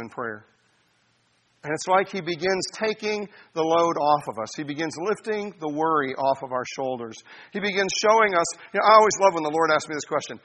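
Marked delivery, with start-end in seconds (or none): none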